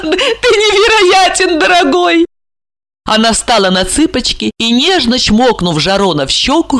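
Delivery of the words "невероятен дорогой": высоко, медленно, сквозь смех; смеяться на слове невероятен; со смехом. Acted. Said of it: "Ольга рассмеялась". (Ольга смеётся, говоря с Жароном)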